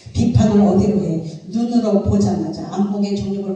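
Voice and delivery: loud, pointed question to the congregation; loud preaching. Acted. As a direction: call it out to the room. (회중에게 크게 외치는 설교)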